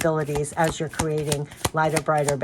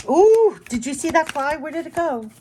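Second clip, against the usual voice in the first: high-pitched real surprise, starting loud; startled; 10 semitones higher, 5 dB louder than usual. Real, not acted. Reader spots a fly mid-reading, startled. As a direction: squeal "ooh", then ask in a high, startled voice.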